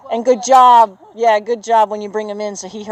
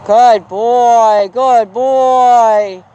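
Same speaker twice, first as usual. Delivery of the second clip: drawn-out praise, a little louder; praising the horse; 2 semitones higher, 4 dB louder, much slower than usual. Real, not acted. Trainer praising her horse from the ground, drawn-out praise. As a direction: reward him with your voice, stretch out good.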